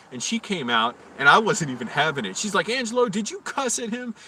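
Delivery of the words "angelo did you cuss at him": high-pitched imitation of a scolding boss; manager's reproach. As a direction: confront him in a raised, higher register. Manager confronting him, acted.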